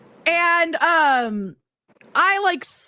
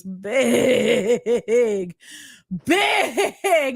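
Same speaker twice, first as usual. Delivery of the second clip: BIG stretched long, both times; slow, elongated BIG for comic emphasis; drawn-out BIG; 4 semitones lower than usual. Real, not acted. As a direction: drag out each BIG to show huge size. Stretching the word for clown's size.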